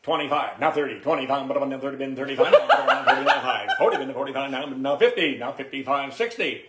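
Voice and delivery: rapid auctioneer chant, loud and high. Shown as a demonstration. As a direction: rattle off bids like selling at an auction. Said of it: "Auction me off this creamer".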